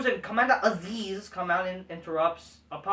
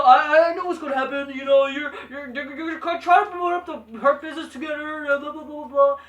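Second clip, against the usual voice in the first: higher, stammering mock promo; lightly hammed-up, scornful imitation; mocking quote; 5 semitones higher, 5 dB louder than usual. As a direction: rattle it off dismissively into blah-blahs.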